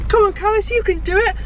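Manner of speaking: high, bright encouraging coach; cheerleader voice. Retold or imitated. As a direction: cheer her on in a squeaky voice. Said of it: "I literally went".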